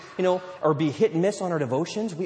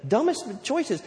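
higher-pitched sheepish plea; acted confession, pitched up; confessing believer; 6 semitones higher than usual. Believer admitting repeated bad choices to God, sheepish plea. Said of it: "and we say".

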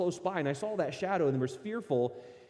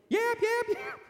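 very high, squeaky little dog yaps; imitated yapping; 3 dB louder, slower than usual. The scared dog yapping as it runs away. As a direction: yelp like a small dog running off in fright.

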